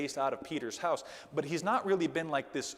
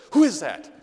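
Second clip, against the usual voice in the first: a startled daughter's loud cry; frightened cry; 13 dB louder than usual. Retold or imitated. Daughter woken at night, frightened loud cry. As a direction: cry out in the dark, scared. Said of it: "She says".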